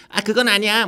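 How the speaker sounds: high, loud cartoon-style outburst; acted character line. Demonstrates his two-line character role.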